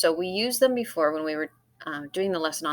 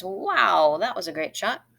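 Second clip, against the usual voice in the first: "Wow" called out louder, amazed; exclaiming wow; 2 semitones higher, 6 dB louder, slower than usual. Shown as a demonstration. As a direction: exclaim the wow like a great shot. Reads example sentence.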